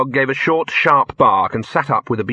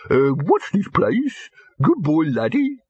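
very slow, vacant dog voice, higher; a handsome dog with nothing upstairs; dim slow dog; 6 semitones higher, slower than usual. A narrator's reading. Dim dog repeats itself.

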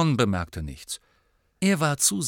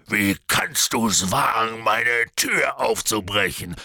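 slow, heavy, indignant rebuke; outraged giant; 4 dB louder, slower than usual. A narrator's reading. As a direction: scold slowly in a big character voice. Hagrid rebukes Harry as teacher.

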